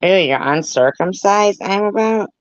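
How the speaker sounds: high-pitched incredulous disgust; disgusted disbelief. Real, not acted. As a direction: recoil in disgust, voice jumping up. Disgusted reaction to a viewer.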